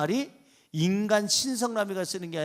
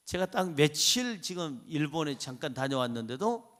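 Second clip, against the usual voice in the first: ordinary talk, nothing marked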